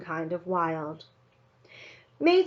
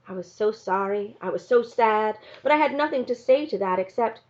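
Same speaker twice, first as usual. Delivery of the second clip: ordinary delivery, nothing marked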